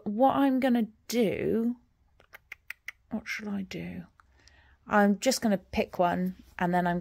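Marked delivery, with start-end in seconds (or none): 3.1-4.0 whispering. Real, not acted. Musing aloud to herself.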